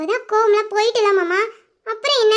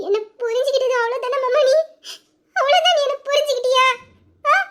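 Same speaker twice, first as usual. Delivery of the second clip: tearful pleading; 2 semitones higher than usual. Acted. Wife crying to her husband after he sends her away. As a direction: sob out how wronged she feels.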